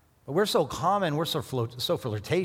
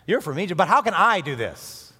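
Joseph protesting loudly; 6 semitones higher, 7 dB louder than usual. Retold or imitated. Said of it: "Maybe Joseph thought".

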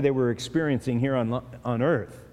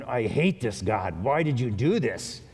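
inner resentment; 4 semitones lower than usual. Retold or imitated. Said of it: "And inside you're going".

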